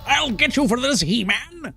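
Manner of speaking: high, raspy cartoon villain shriek; sputtering foiled villain. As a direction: rant like a beaten cartoon baddie.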